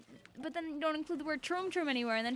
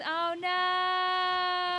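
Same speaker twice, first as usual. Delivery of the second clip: mock dismay; 5 semitones higher, 5 dB louder than usual. Game moment, dramatic cry.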